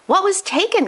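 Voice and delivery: high, breathless worried mom; gasped question. Acted. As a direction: gasp it out like an alarmed mother. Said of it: "gasped Mom".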